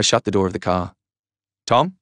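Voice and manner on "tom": higher, louder call after him; raised calling voice. A narrator's reading. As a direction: call his name as he walks off. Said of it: "Brendan called".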